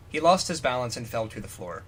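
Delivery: ordinary talk, nothing marked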